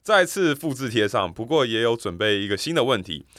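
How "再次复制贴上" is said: ordinary talk, nothing marked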